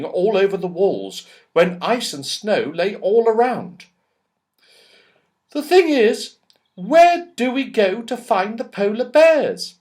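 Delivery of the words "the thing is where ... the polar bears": put-on high girlish voice, a little louder; bright worried little girl asking; Goldilocks. A narrator's reading. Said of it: "said Goldilocks".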